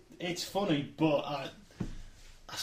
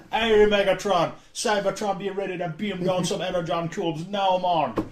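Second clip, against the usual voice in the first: put-on Caribbean accent; 3 semitones higher, 5 dB louder, slower than usual. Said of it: "funky Caribbean accent".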